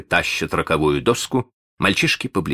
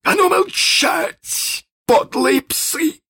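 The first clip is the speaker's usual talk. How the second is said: high, drawn-out commanding yell; pirate captain's shout; 3 dB louder, much slower than usual. Acted. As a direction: shout down the crew, stretching the words. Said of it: "крикнул он".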